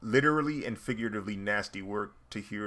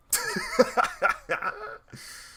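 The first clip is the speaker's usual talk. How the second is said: very high-pitched burst of laughter; helpless laughter; 3 dB louder than usual. Real, not acted. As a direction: crack up for real, high and unrestrained. Laughs at his own punchline.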